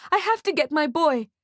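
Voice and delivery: high, desperate mother; yelling. A narrator's reading. Mother must reach her boy, desperate high yell. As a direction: plead urgently to be released. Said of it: "she yells".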